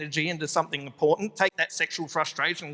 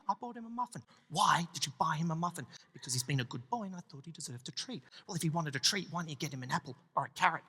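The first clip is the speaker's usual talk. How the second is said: much softer, tense couple fight whispered; hushed angry argument; 3 semitones lower, 8 dB quieter than usual. Retold or imitated. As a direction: fight quietly so nobody hears. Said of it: "hushed, angry argument".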